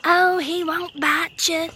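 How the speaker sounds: high, slow mock reassurance; put-on high voice of a bystander; imitating a bystander. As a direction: mimic a dismissive dog owner, drawn out. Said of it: "The guy that was standing there was like".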